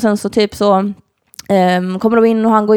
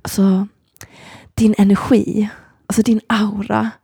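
whisper; slower than usual. Retold or imitated. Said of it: "Han bara så här".

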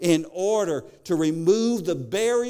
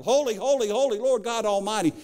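angels crying out; 6 semitones higher than usual. Retold or imitated.

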